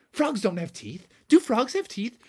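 put-on puzzled kids. Kids in the audience doubt frog teeth, high puzzled voices. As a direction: pipe up like a puzzled child.

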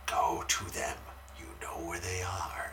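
soft, unvoiced whisper; muttered under breath. Annoyed moviegoer inwardly telling the standing guy to move, whispered. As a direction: hiss it to yourself. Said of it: "And I'm just like".

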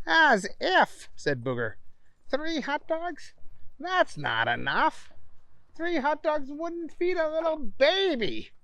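high, mocking boy voice; scoffing. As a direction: laugh off the idea. Booger scoffs at portions.

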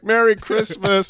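slow, high, hammed-up festive greeting; gift-giver's greeting. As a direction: draw it out in a high mock-cheery call. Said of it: "a security guard was like".

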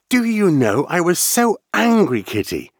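put-on high, loud girlish scold; a worked-up little girl telling off her kitten; Alice, excited scolding. A narrator's reading.

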